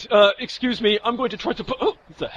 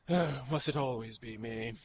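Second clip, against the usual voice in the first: weary sigh; 7 semitones lower, 13 dB quieter, slower than usual. Acted. Weary sigh before task.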